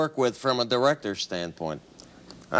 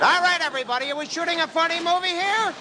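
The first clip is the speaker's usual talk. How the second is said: hammed-up director; 12 semitones higher, faster than usual. Director cheering on his crew before the take, high and hearty. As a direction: pump up the cast, voice pitched high.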